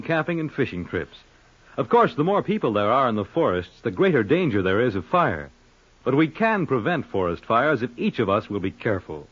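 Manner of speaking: ordinary talk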